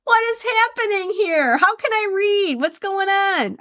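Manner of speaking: high, baffled mock panic; mock bewildered. Host imitating her own reading flubs, high and baffled. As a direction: squeak out the confusion. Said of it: "some days it's like".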